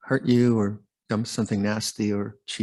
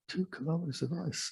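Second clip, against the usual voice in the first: much softer, higher-pitched aside; hushed amazement; 6 semitones higher, 11 dB quieter than usual. Real, not acted. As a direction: repeat it in quiet wonder at the amount. Repeats the figure to marvel at it.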